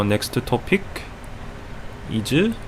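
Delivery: hesitant put-on Korean accent. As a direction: say it haltingly in a Korean accent, searching. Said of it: "with the Korean accent".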